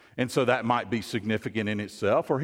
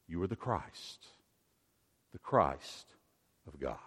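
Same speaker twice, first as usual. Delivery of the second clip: hushed reverence; 8 semitones lower, 10 dB quieter than usual. Acted. Peter's confession, drops to hush.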